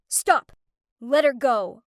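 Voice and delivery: a firm, cutting order; sharp command. Acted. Rescuer ordering the boys to let her go, sharply. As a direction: stop them cold with it. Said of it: "a voice behind me sharply say".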